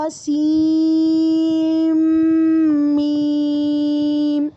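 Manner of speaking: سین میم drawn out, the نون merged into میم; slow teacher demonstration of merging; very slow model reading. Shown as a demonstration. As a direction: stretch سین and glide straight into میم with a long m sound. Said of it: "دیکھو ایک بار سنو".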